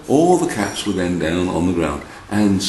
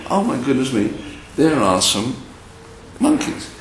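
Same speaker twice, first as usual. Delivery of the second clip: raised pitch, astonished exclamation; storyteller's amazement; 7 semitones higher than usual. Acted. Storyteller spots the monkeys in the tree, amazed. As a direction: react as if just spotting something astonishing.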